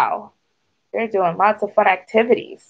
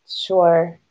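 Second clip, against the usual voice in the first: mild, resigned assent; sighing agreement; 3 semitones lower than usual. A narrator's reading. Duck gives in to Thistle, sighing. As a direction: agree with a touch of a sigh. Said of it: "Duck sighed".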